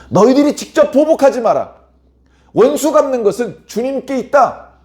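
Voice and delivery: high, loud proclamation; forceful command. Acted. Preacher gives God's word against taking revenge, forceful command. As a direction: proclaim it as a divine order.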